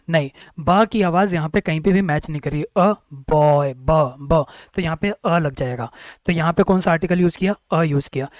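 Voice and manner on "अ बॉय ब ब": बॉय stretched very slowly, then a clean ब repeated; slow sounding out. Shown as a demonstration. Teacher breaking a word into sounds. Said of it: "ब क्या इन आठ sound".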